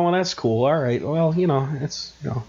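ordinary delivery

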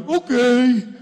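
loud, drawn-out reckless cheer; whooping consent. Acted. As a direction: whoop it out as if giving in. Mimics giving in to pleasure.

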